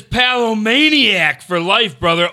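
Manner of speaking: slow, high, drawn-out shout-out; hype announcer read. Acted. Reads super chat in hype voice.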